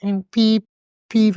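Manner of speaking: high mock beep sound; high imitated beeping; scanner beep. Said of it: "and I was like".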